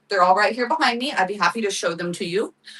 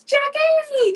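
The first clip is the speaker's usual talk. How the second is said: high excited shout of a name; joyful hello to viewer Jackie; excited call. Real, not acted. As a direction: call out to a friend with delight.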